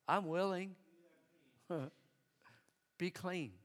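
soft, low and gentle, almost hushed; tender Jesus. Acted. Jesus softly telling the leper he is healed, tender hush. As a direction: speak healing words tenderly.

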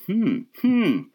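high, hammed-up thinking hum; mock pondering. Comedian retelling being quizzed on band members, mock pondering. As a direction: hum as if searching your memory. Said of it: "I said".

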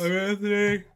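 yawning through the name. Real, not acted. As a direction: say it mid-yawn. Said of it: "You always yawn at your introduction".

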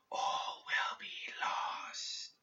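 very slow, faint whisper; a breath like a gentle gust; far-off whisper. A narrator's reading. Spirit fading into the distance, far-off whisper. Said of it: "its voice was so far away now".

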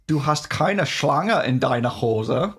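slow, hammed-up German; over-pronounce it in mock German; strong German accent. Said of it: "a really strong accent".

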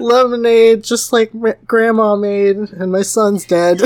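high hawker's call; mock lemonade vendor. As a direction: call out wares, cheerfully absurd. Continues mock vendor bit.

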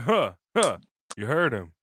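low, menacing mock Roman; a light take on a sinister boss; Darth Vader voice. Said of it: "talking like Darth Vader".

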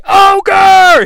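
high, loud party cheer; crowd yell. Retold or imitated. Frat crowd cheering the Ogre as he walks in, high and loud. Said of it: "he walks into the frat party".

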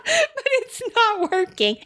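high, teary laugh on the words; laughing through tears. Real, not acted. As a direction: laugh it off while sniffling. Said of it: "if you hear me sniffling".

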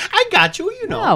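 very high, loud put-on voice; reassuring character voice. [name] reassures Ruby in character.

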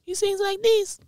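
very high, soft falsetto; high-pitched singer impression. Showing how the singer sings, high-pitched. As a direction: jump way up into a thin falsetto. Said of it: "like, a high-pitched voice".

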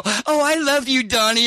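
high, gushing fake affection; exaggerated sweetheart act; mock lovey-dovey. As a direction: gush sweetly to spite him. Mock affection while crushing his hand.

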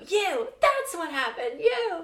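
high, loud mock TV-drama outburst; imitated yelling. Friend re-enacting a yelled scene from a show, mock yelling. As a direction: yell it like an accusation, half laughing. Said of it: "The part where someone is yelling".

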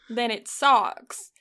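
slow Southern drawl on socks; drawn-out Southern "socks". Shown as a demonstration. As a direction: stretch socks into almost two syllables, Southern. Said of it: "When you say it in Southern".

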